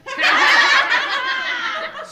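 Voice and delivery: high, loud burst of laughter; laughing. Acted. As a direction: laugh out over the noisy class. Teacher laughing at class. (خندهٔ بلند و زیر)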